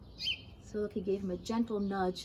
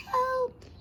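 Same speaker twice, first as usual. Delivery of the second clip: feeble groan; 13 semitones higher, 4 dB louder than usual. Acted. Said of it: "he groaned feebly".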